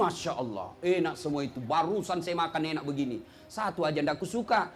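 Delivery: put-on low contented old man praising food; old father voice.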